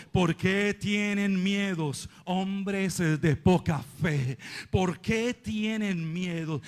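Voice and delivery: low, slow, measured rebuke; Jesus rebuking. Acted. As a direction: calmly question their fear, unhurried. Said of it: "él les contestó".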